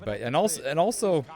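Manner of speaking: stumbling restart on the same words; stuttering. Real, not acted. Commentator losing his thread mid-analysis, stuttering. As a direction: trip over the phrase and restart it. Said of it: "as I'm stuttering".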